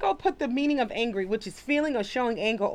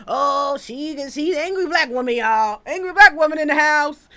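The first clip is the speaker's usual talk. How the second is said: higher, louder mocking of accusers; mock alarm; 4 semitones higher, 6 dB louder than usual. Woman mimicking people who label her angry, mock alarm. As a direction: imitate people raising a loud mock alarm.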